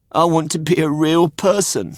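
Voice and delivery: put-on high, wistful boy voice; a puppet wishing aloud; high wistful Pinocchio; puppet boy's voice. Pinocchio speaks in the story.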